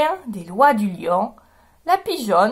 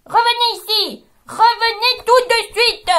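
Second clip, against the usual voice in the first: lion bellowing, much higher and louder; roaring shout; 9 semitones higher, 4 dB louder than usual. Acted. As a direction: roar them back. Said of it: "il rugit". (le lion rugit de toutes ses forces)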